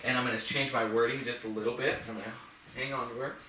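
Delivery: usual speaking voice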